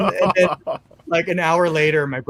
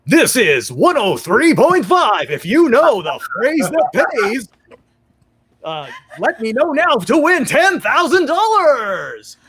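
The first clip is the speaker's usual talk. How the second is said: higher, louder, slow radio announcer; 7 semitones higher, 4 dB louder, slower than usual. Retold or imitated. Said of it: "And he's like".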